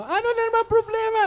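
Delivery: high, loud snapping mom; mother cutting off her child, irritated high snap; angry mom. Retold or imitated. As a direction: snap at the kid before hearing the problem. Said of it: "mami ko talaga".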